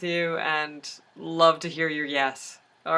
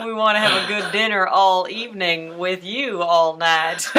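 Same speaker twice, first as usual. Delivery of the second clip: laughing; 2 semitones higher, 4 dB louder than usual. Real, not acted. Laughing while inviting friend.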